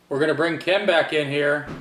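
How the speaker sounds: normal talking voice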